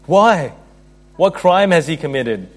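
forceful narrated protest; Pilate's challenge. A narrator's reading. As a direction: challenge the crowd with mild indignation. Pilate challenges the crowd's demand.

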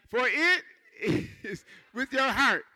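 loud, high scripture read. Real, not acted. Preacher reading the verse aloud to the church, loud and high. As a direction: read it out to the back row.

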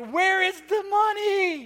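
high, loud and slow, a frantic wail; desperate mock panic; panicked cry. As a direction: call out in alarm as if the money's gone. Parents panicking over lost cash.

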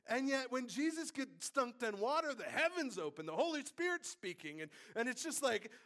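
high, loud, impassioned preaching; preaching at full force. Real, not acted. Preacher confesses powerlessness to congregation, full-force preaching. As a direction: raise the roof on human helplessness.

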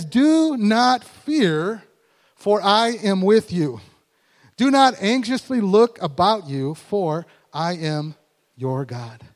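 loud, very slow proclamation; slow booming scripture declaration; full-force preaching. Acted. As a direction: declare every word to the room.

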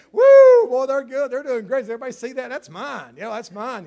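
whooping proud grandparent, loud; proud bragging. Retold or imitated. Preacher voicing a grandparent bragging about a grandkid, whooping. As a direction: brag about the grandkid, thrilled.

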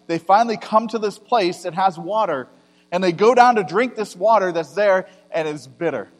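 ordinary delivery

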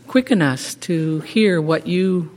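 usual speaking voice

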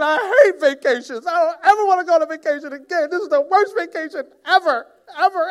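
high, loud mock child wail; hammed-up child meltdown; whining child tantrum. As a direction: shriek it like a kid who lost their PJs. Preacher voices whining child.

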